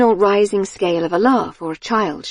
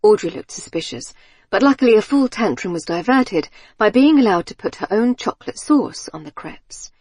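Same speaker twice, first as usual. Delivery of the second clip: ordinary delivery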